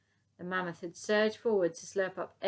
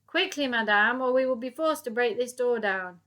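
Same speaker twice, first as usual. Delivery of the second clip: loud, commanding police voice; shouting; 4 semitones higher, 10 dB louder than usual. Acted. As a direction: bark it through the door. Police through door.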